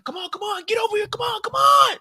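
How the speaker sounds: high frantic shouting fed; panicked fed. Retold or imitated. Fed yelling at her to hurry, high and panicked. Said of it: "He's like".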